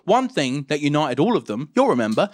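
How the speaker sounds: ordinary talk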